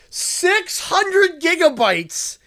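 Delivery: stretched out slowly in disbelief; slow and disbelieving; slow incredulous emphasis. Real, not acted. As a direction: drag out each word, still amazed. Stunned by the huge gift.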